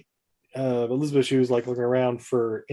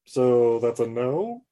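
lightly voiced leering ape; 2 semitones lower, much slower than usual. Ape watches her leave the bath, slow sly tease. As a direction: drawl it out like a disappointed creep.

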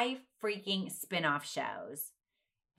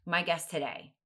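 usual speaking voice

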